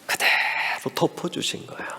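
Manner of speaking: hushed, unvoiced key line; whispered tender conclusion. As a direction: whisper it like a secret of grace. Hushed key point in sermon.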